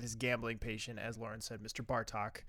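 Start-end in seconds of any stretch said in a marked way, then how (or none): none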